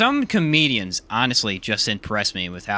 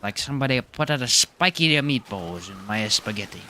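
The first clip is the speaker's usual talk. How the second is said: complaining about spaghetti in a fake Italian accent; a hammed-up Italian grumble; lightly done Italian accent, half joking; mock Italian accent; 4 dB quieter than usual. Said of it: "It's supposed to be Italian".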